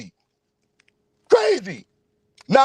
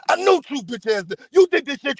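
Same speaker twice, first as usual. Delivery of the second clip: ordinary talk, nothing marked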